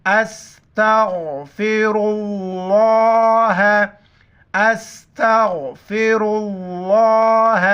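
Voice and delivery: slow استغفر اللہ with a thick ra and full اللہ; heavy ra. Shown as a demonstration. Teacher modelling the heavy letters for pupils, slow careful recitation. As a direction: draw it out, making ra and اللہ heavy. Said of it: "را کو بھی موٹا پڑھیں گے".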